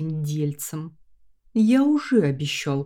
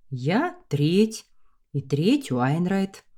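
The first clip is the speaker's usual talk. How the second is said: ordinary delivery, nothing marked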